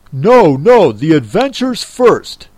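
loud, emphatic interruption; impatient Griffin. Acted. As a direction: cut in forcefully, out of patience. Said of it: "in an impatient tone".

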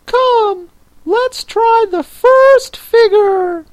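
very high-pitched creature voice; Mock Turtle. A narrator's reading. Mock Turtle explains the lobster quadrille to Alice, very high voice.

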